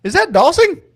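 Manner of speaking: high, loud disbelieving question; astonished. Real, not acted. Host spotting an old friend in the chat, astonished. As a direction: blurt it out in real astonishment.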